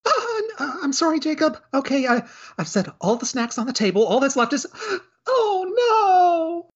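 a timid maid caught out, sorry and panicking; flustered clumsy maid. Acted. Maid Felicia apologises to butler Jacob and then drops the snacks, in a high flustered voice.

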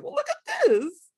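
high, slow, laughing delight; delighted look. Retold or imitated. Showing off new knives, a slow laughing delight. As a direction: marvel at the knife with a laugh. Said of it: "it's like".

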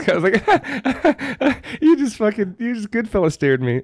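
laughing while retelling. Retold or imitated. Retelling mortified reply through laughter.

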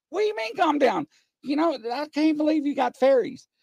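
can't believe it, high and fast; excited disbelief. Retold or imitated. Retelling seeing a fairy.

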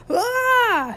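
high, loud falling scream. A narrator's reading. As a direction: yell as the bunk collapses. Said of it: "screamed Harold".

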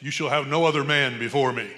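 loud mock commanding wife. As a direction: boom it out like a jealous wife laying down the law. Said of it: "My wife".

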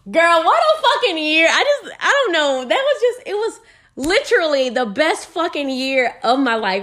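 thrilled about her year; excited gushing. Real, not acted. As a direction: gush about the best year ever. Raving about her best year.

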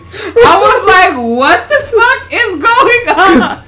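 slow, high, loud through laughter; laughing. Retold or imitated. On the floor over the walkout, slow laughing words. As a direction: squeeze it out while cracking up.

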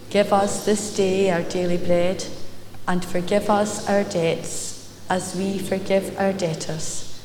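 soft, hushed recited prayer; reciting the Lord's Prayer. Acted. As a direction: murmur the prayer with reverence.

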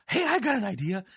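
high far-off divine voice; echoing ethereal energy being. Retold or imitated. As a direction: play God as an airy, otherworldly spirit. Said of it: "And God's all".

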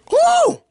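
loud, very high "woo"; excited whoop. Real, not acted. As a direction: whoop with pitch right at the top. Whooping after another impersonation.

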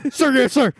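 high, barked sir yes sir; drill sergeant. Pretend. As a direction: snap off the sir like a recruit. Military reply, high and loud.

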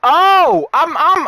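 sudden loud realization; high, loud oh of understanding; surprised. Real, not acted. Suddenly gets the point.

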